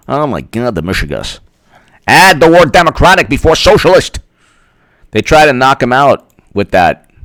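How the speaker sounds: ordinary talk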